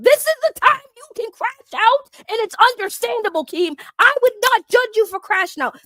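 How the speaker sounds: rising heated plea; heated urging. Real, not acted. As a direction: pitch up and loud, pushing him to let go. Urging Keem to react.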